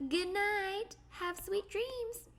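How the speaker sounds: character voice. Pretend. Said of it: "this character says".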